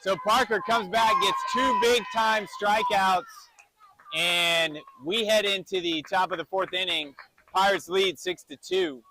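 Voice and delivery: loud, high strikeout call; excited play-by-play. Real, not acted. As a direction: belt out the big strikeout, then announce the score.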